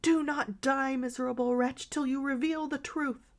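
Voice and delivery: high, urgent and trembling; shaking with excitement. A narrator's reading. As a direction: demand it, barely holding together. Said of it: "shaking with excitement".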